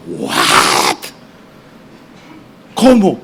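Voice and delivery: low, baffled "what?" then a sharp "¿cómo?"; stunned disbelief. Retold or imitated. People stunned the visa turned up, disbelief. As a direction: act out a crowd that can't believe it.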